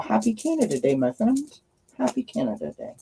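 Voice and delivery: ordinary delivery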